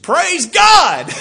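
slow, high, loud exhortation; exultant shout. Real, not acted. Preacher urging the congregation, a slow high shout. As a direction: call out for praise.

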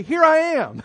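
high, loud "Here I am"; loud, quick reply to a parent's call; eager answer. Pretend. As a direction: snap to attention when mom calls. Child answering mother's call.

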